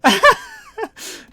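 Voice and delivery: squealing laugh; high burst of laughter. Real, not acted. Reacts to hug Bongus.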